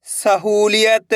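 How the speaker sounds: word stretched very slowly; drawn-out reading. Shown as a demonstration. Teacher reading a word for learners, drawn out. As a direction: read it out with long held vowels. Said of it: "एक अक्षर का उच्चारण करना है".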